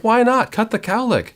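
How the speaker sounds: high-pitched mock pleading with the hairdresser; retold protest. Host retelling his plea to hairdresser about a cowlick, high-pitched protest. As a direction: push the pitch way up, imitating an incredulous customer.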